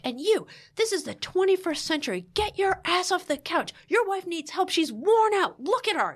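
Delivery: high, forceful telling-off; acted-out therapist laying into the husband; scolding tirade. Therapist orders husband off the couch, scolding hard. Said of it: "then he turned to Tom and he was like".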